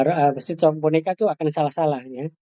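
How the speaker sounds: usual speaking voice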